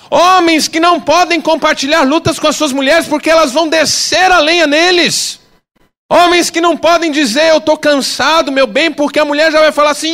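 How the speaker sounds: loud, high, driving sermon; fiery preaching. Real, not acted. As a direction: preach it hard to the congregation. Preaching at full force.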